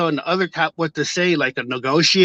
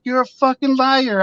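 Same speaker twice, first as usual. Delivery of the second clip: sharp high shouted retort at the officer; retold shout; 8 semitones higher than usual. Shouting back at the lying officer, angry yell. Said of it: "and I shouted back there".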